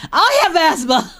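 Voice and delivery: loud, high-pitched exclamation; startled outburst. Real, not acted. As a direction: blurt it out in startled disbelief. Startled reaction to game description.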